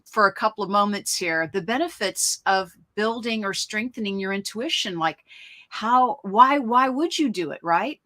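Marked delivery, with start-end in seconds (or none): none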